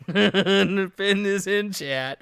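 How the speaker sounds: laughing. Real, not acted. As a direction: crack up while speaking. Cracking up at bad impressions.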